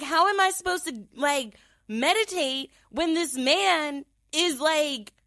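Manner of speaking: high-pitched, worked-up protest; hammed-up retold indignation; exasperated complaint. Retold complaint about the snoring.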